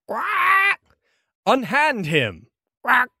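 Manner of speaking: high, slow squawking bird voice; Kenku calling down. Acted. Second Kenku calls down from a nearby rooftop, high squawking command. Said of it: "and calls down to you".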